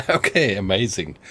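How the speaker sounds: thrilled laughing reaction; laughing delight. Real, not acted. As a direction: burst out laughing, then amazed. Delighted by what he hears.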